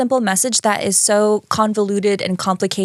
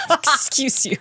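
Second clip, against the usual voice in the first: laughing tease; 5 semitones higher than usual. Real, not acted. Teasing co-host while laughing.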